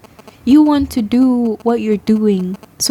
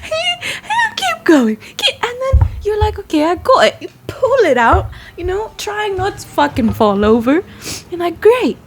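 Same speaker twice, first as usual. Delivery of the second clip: laughing; 10 semitones higher than usual. Real, not acted. Still laughing through the story.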